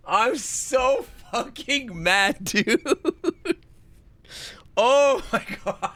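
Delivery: high, drawn-out moans breaking into laughter; so mad it turns into helpless laughing; laughing fury. Real, not acted. Streamer so mad at the puzzle, laughing fury.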